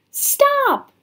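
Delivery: very high cry of protest; shouting. A narrator's reading. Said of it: "she shouted again".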